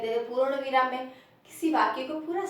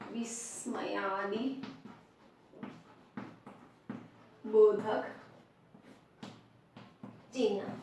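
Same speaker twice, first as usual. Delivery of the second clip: very slow, word-by-word dictation; 3 semitones lower, 5 dB quieter, much slower than usual. Shown as a demonstration. Teacher writing out a term for the class, dictated slowly. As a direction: dictate it slowly with long pauses.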